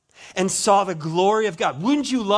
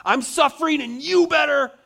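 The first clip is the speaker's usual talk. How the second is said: demanding complaint; 9 semitones higher, 4 dB louder than usual. Pretend. Mock demanding Stephen.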